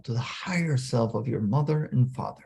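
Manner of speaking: usual speaking voice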